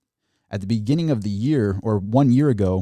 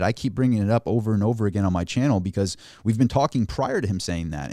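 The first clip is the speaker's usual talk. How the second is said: ordinary talk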